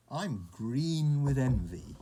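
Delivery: loud, drawn-out mock envy; playful envy. A narrator's reading. Frog admiring panda's new clothes, playful drawn-out envy. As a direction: stretch it out with playful emphasis.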